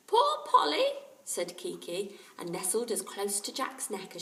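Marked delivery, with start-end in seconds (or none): 0.1-0.9 high, small, sad squawk; a pitiful parrot begging at the door. A narrator's reading. Kiki the parrot outside the door, small and sad.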